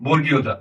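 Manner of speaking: "বর্গীয় জ" said distinctly as a model; standard pronunciation. Shown as a demonstration. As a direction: pronounce the letter name "বর্গীয় জ" carefully for learners. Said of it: "এটার উচ্চারণ হবে".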